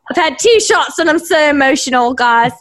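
high, overwrought confession; tipsy emotional. Real, not acted. Co-host after two shots, high emotional wail. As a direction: wail it to your friends. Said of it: "I'm very, very emotional".

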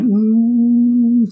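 high, loud strained hum through chest pain. Retold or imitated. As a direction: let a tight hum escape as the pain hits. Chest pain while running.

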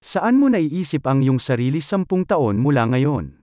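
ordinary talk, nothing marked

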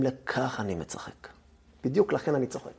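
whispered, voice dropped right down; hushed answer. Acted. Rabbi Akiva answers the weeping rabbis in a hushed whisper. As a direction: let Akiva answer them in a near-whisper.

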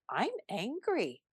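softly naming the feeling; quiet realization. Retold or imitated. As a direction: notice it, say it gently. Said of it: "I caught myself and I said".

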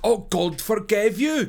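loud, slow, high cry of despair; anguished cry. A narrator's reading. Mother cries out at her daughter, loud and anguished. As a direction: wail it out as if the floor gave way. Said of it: "cried Madame Charpentier".

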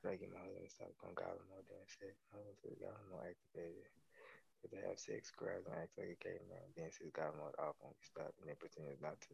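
low-key, very soft read-aloud; quiet reading. Real, not acted. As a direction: read it very softly, almost to yourself. Reads goblin mode text from gallery.

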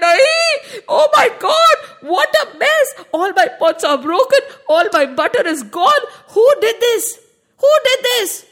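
high, loud outraged shouting; shocked angry shout. A narrator's reading. Gopi auntie shouting at her broken pots. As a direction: scream at the wrecked kitchen.

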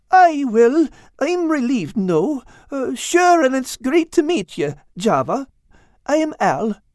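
high little mouse voice; squeaky small character. Acted.

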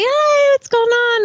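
bright, high phone greeting; chirpy high friend voice. Retold or imitated. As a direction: answer the phone in a high, cheery voice. Imitating friend answering phone.

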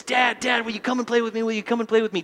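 hint of a son begging dad to play; lightly voiced pleading son. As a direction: nag like a little boy wanting to play. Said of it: "And he says".

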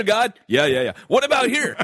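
playful high pretend voice asking God; mock talk with God. Acting out asking God about places to go, playful and high.

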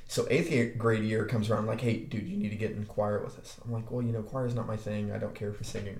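ordinary talk

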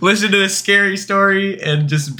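high-pitched talk through laughter; helpless laughing delivery. Real, not acted. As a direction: try to get it out while cracking up. Cracking up at own joke.

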